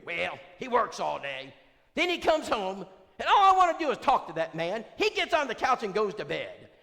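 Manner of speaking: high, loud falsetto grievance; high loud falsetto, a complaining wife; Brenda complaining. Retold or imitated. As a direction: complain about the husband in her voice. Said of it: "Brenda complains".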